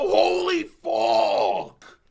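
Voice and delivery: high, slow, drawn-out holy fuck; stunned scream. Real, not acted. Reader stunned by the nuke panel, drawn-out scream. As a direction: scream it in disbelief.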